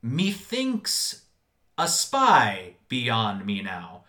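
put-on slow, grand old-English declaiming; Shakespearean. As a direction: recite it like a Shakespeare actor.

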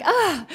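high mock yelp; a caught kid's squeal; startled ah. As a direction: yelp like a kid in trouble. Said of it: "it's just like".

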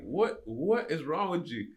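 high, very drawn-out mock question; playful disbelief. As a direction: stretch it out as an amused, baffled driver. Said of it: "like, playfully laughing".

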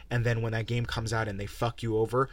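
ordinary talk

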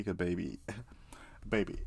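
playful hushed whisper; half-laughed whispered warning; mock-warning whisper. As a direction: whisper it like a joking threat. Jokey whispered warning to listeners.

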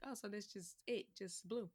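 soft, dropping, uneasy; uneasy murmur. Retold or imitated. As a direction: murmur in quiet dread. Uneasy at endless ocean.

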